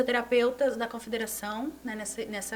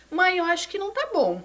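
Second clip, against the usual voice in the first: worried plea; 8 semitones higher, 6 dB louder than usual. Retold or imitated. Telling mother she feels bad.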